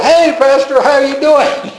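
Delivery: high, overly bright hello, covering up; fake cheerful greeting. Family member opens door to the pastor, mock innocent high greeting. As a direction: put on an innocent welcome for the preacher.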